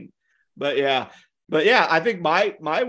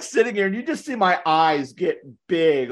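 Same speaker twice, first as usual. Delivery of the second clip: ordinary talk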